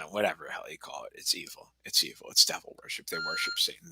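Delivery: hushed, breathy warning; whisper. Real, not acted. Streamer confiding to chat about Satan worship, whispered. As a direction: whisper it like a dark secret.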